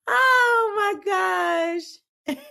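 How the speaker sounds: very high, slow, drawn-out "oh my gosh"; laughing exasperation. Real, not acted. Host overcome by her coworker-like friend, very high slow laughing. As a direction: stretch it way up as the laugh winds down.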